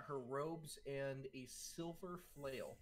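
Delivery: normal talking voice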